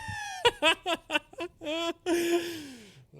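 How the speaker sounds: long, high cackling laugh; real burst of laughter. Real, not acted. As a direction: crack up loudly and helplessly. Cracking up at the slogan.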